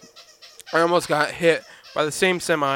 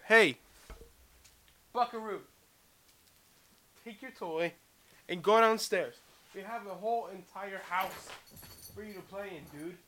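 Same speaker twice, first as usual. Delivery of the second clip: soft coaxing; 2 semitones higher, 13 dB quieter than usual. Real, not acted. To his dog, coaxing him downstairs, very soft. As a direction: gently shoo the dog away, very soft.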